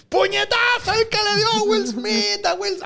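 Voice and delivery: high, slow hammed-up announcer; slow, high mock broadcast exclamation; mock announcer shout. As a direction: call it like a big sports replay.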